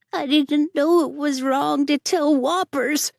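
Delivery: put-on high, loud, slow child's sobbing plea; sobbing boy. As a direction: cry it out like a scolded boy. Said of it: "he sobbed".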